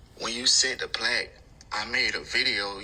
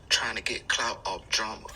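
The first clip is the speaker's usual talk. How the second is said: ordinary talk, nothing marked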